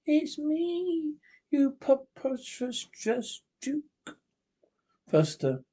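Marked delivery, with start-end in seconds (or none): 0.0-4.2 high, eerie, otherworldly witch voice, slow at the end. Acted. Witch Hester mocking the judge, high eerie witch voice. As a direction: sneer at him like a ghostly witch.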